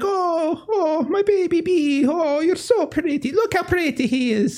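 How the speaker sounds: put-on high, cooing baby talk; high sweet wheedling noblewoman; gushing Maria. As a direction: coo over the little one in a high, gushing voice. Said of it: "She grabs, like".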